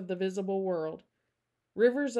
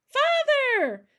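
high, loud joyful shout; squealing little-girl greeting; delighted child's cry; 10 dB louder than usual. Acted. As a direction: call out to a father just come home. Child calls out on seeing father.

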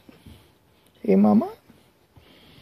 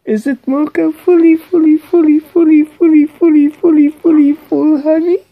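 put-on singsong baby talk; 12 semitones higher, 6 dB louder, faster than usual.

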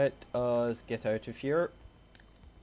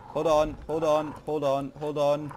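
urgent; 4 semitones higher, 6 dB louder than usual. Real, not acted. Urgent in game.

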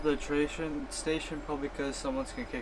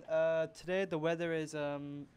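ordinary delivery

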